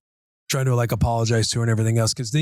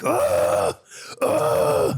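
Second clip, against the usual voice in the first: mock anger noises; 4 semitones lower than usual. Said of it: "anger noises".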